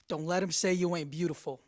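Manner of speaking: ordinary talk, nothing marked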